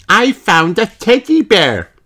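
high, loud piglet voice; excited child, far up in pitch; Peppa. A narrator's reading. Peppa points out a discovery, high and loud. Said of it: "says Peppa".

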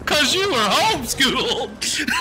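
high, slow mocking punchline; hammed-up taunt; imitated roast. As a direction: stretch it out to tease. Imitates Dave's roast of Isaiah.

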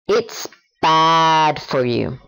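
slow, deliberate model sentence. Shown as a demonstration. Teacher giving a slow example before the learner repeats. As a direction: say each word of It's bad for you slowly and clearly. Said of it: "Ahora tú".

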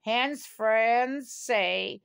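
ordinary delivery, nothing marked

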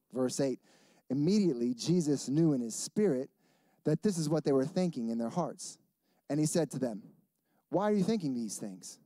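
hushed, reverent reading of the verses; soft scripture reading. Acted. Reads scripture softly.

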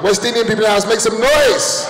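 rally the room like a hype man; crowd callout. Acted. Comic calling out to West Indians, shouted hype.

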